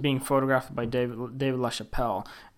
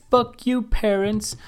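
high, loud mock defiance; rebellious defiance; 8 semitones higher, 3 dB louder than usual. A teenage girl defying her parents, high and loud. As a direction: toss it off like a rebellious teen.

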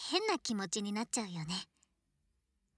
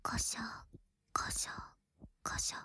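tickly ear-cleaning noises; 6 dB quieter than usual. Acted. Ear cleaning sounds voiced.

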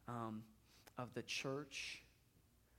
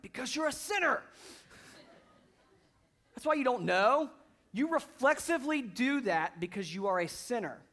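loud, high, mock-stern father scolding; half-joking roar at the kid; mock lecturing dad; 10 semitones higher, 13 dB louder than usual. Father imagining the reply he missed, loud and high. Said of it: "I'm like".

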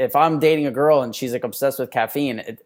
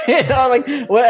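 laughing disbelief; 10 semitones higher, 5 dB louder than usual. Real, not acted. Reacting to absurd Mumbai answer.

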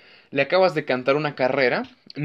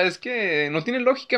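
ordinary delivery

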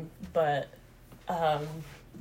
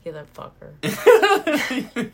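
slow, loud and high through laughter; words drawn out in a laugh; 6 semitones higher, 10 dB louder, much slower than usual. Real, not acted. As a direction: crack up while saying it. Laughing reaction to impression.

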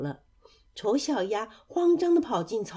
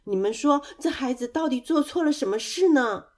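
raised, higher pleading cry; loud protest; 5 semitones higher, 4 dB louder than usual. Acted. Mother duck shielding her duckling from mockers, crying out. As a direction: call out loudly to stop them. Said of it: "大声地叫着说".

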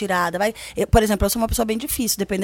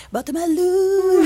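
slow, higher, teasing repeat; seductive tease; 6 semitones higher, 3 dB louder, slower than usual. Acted. Describing kinky fun to friends, slow teasing tone. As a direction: purr it like a tease.

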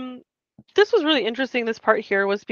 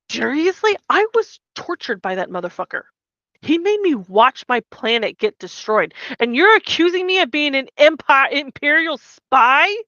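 louder, higher indignant rant; outraged retort; 5 semitones higher, 7 dB louder than usual. Retold or imitated. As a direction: fling the accusation back.

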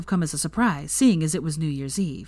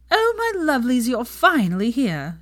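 crying out; 7 semitones higher, 3 dB louder than usual. A narrator's reading. Pansy greets her late friends, high and gushing. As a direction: sing it out to old friends. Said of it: "she cried".

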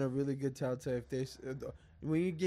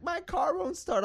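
put-on much higher, slow girly voice; imitating a girl; slower than usual. Retelling the stranded driver's reply, high slow girly voice. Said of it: "this fine ass chick was like".

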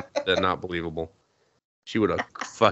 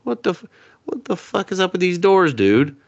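exasperated rant; 10 semitones higher, 5 dB louder than usual. Real, not acted. Exasperated rant about doors.

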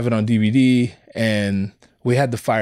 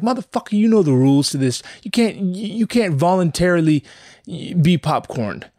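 indignant rant; 7 semitones higher than usual. Retold or imitated. Mock scolding of the kid who volunteers to read, outraged. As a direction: tell the eager kid off like he broke a sacred rule. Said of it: "It's like".

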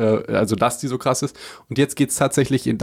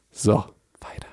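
hushed quick whisper; 4 dB quieter than usual. Real, not acted. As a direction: drop to a whisper to move on. Whispered aside after mock narration.

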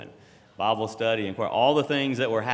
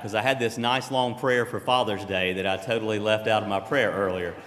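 ordinary delivery

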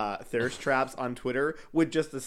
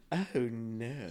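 put-on posh accent; 4 dB quieter than usual. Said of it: "like a eighteen hundreds, like, rich person".

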